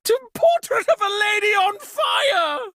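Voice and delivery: high, shocked shout of a made-up oath; startled cry. Acted. Host startled by the blackout, high shocked shout. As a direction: yelp the oath in sudden alarm.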